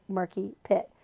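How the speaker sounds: usual speaking voice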